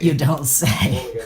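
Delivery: drawn-out sarcasm. Real, not acted. Dry reply to TV line.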